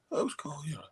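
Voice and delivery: breathy whisper, no voice at all; whispered quote. Retold or imitated. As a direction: drop to a secret whisper. Quoted friend answering quietly.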